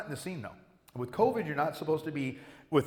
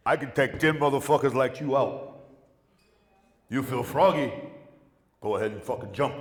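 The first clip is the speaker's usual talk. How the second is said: acted tough challenge; 5 dB louder than usual.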